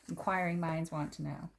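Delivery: ordinary delivery, nothing marked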